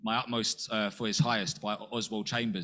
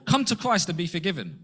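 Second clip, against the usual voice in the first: higher and louder, a mock street call; preachy slogan; 7 semitones higher, 5 dB louder than usual. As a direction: proclaim it like a stock slogan.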